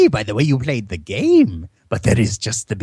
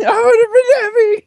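high, fast hammed-up wail; mock sobbing; 5 dB louder, faster than usual. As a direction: wail it like a melodrama. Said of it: "It's such a great line".

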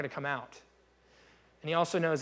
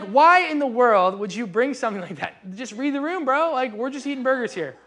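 louder and higher, incredulous scolding; exasperated rebuke; 7 semitones higher, 8 dB louder than usual. Retold or imitated. Guest scolding the show-off at a burger cookout, exasperated and loud. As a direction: read the room, bro, with full disbelief.

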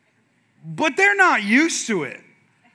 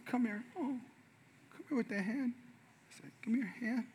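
hushed and gentle, much softer; soft dad voice; 15 dB quieter than usual. Retold or imitated. Dad calls hurt son over softly, hushed.

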